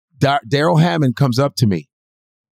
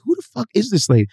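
much higher, fast, baffled; high incredulous; 9 semitones higher, faster than usual. Retold or imitated. Imitating his own confused reaction at the table read, high. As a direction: fire it off in shocked disbelief. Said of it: "And I'm like".

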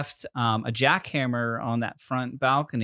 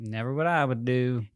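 put-on Southern accent. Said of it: "In a Southern accent".

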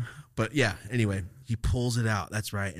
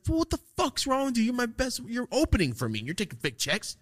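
angry boss; 14 semitones higher than usual. Retold or imitated. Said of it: "my boss is gonna be like".

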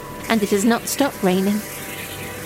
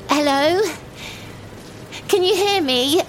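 raised, high call into the rain; 6 semitones higher than usual. Acted. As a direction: call out to someone who might be listening. Calling out into the rain.

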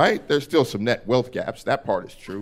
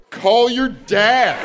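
very slow, high, sing-song; 9 semitones higher, 4 dB louder, much slower than usual. Retold or imitated. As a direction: stretch each word with breezy certainty. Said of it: "I wish I could have been like".

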